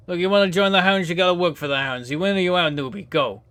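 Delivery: tough New Jersey gang boss; Jersey gang boss sizing up a newbie; Jersey accent. Acted. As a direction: lean into a gruff Jersey mobster accent. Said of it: "I had to do the accent".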